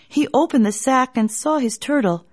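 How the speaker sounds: plain reading voice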